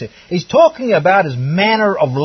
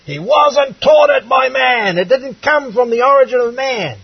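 high, insistent pulpit proclamation; emphatic, raised preacher's cry; forceful preaching; 6 semitones higher than usual. Real, not acted. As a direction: drive the point home to the congregation, voice raised.

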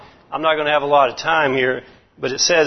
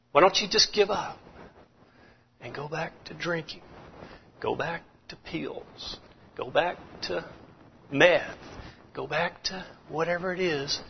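tempting whisper; 10 dB quieter than usual. Acted. Preacher voicing the enemy whispering temptation, hushed and coaxing. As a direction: breathe the temptation softly. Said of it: "when he whispers in your ear".

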